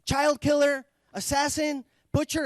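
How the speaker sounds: high-pitched yells from an angry crowd; accusing shouts. Retold or imitated. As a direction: call out one accusation after another. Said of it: "other people chime in".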